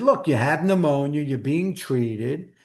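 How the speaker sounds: ordinary talk, nothing marked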